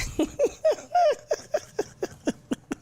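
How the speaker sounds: long high-pitched burst of laughter. Real, not acted. Host cracking up at the chat, high laughter.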